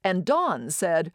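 ordinary delivery

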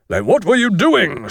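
high, forceful schoolmaster's challenge; stern demand. Acted. Master questions the skulking boys, stern and forceful.

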